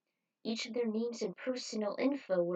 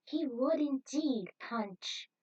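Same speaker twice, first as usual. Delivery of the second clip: deliriously happy; 5 semitones higher than usual. Acted. Judy answers Punch on air, dreamily happy and higher. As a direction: sound giddily brainwashed. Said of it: "dazed but deliriously happy".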